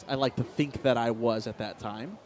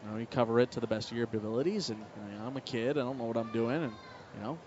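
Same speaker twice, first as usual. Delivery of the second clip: usual speaking voice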